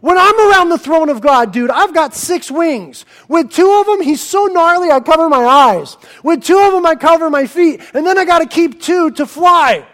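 high, loud surfer-dude angel; casual angel. Acted. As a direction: angel describing the throne room. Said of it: "the angels would say".

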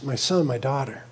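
low, soft and tender; gentle fatherly voice. Retold or imitated. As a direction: reassure a restless child calmly. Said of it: "And the Father says".

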